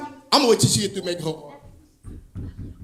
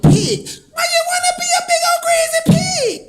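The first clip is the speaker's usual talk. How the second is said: much higher, camp questioning voice. Retold or imitated. Overheard guy asking his friend, high camp voice. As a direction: ask it high and playful. Friend reacting to pig choice.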